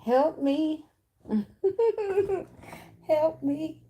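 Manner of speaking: high, squeaky little cry of help me with a laugh between; squeaky plea for help. Acted. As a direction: play a tiny helpless character begging. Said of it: "That's what Lil Boston Richie said".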